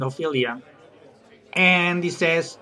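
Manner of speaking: usual speaking voice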